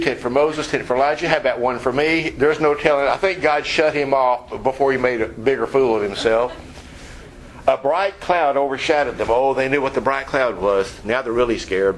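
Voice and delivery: ordinary talk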